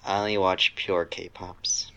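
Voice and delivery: ordinary delivery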